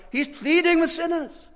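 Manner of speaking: high, pressing pulpit emphasis; urgent preacher's appeal; fervent preaching. Acted. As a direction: lean hard on pleading. Preacher stressing pleading.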